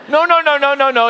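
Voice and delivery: high, loud laughing refusal. Retold or imitated. God laughing off a preacher's request, high and loud.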